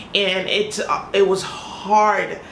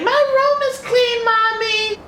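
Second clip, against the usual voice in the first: high imitation of a little kid; child's voice. Kids telling their mother the room is clean, in a high child voice. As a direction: pipe up like a child lying sweetly. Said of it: "and say".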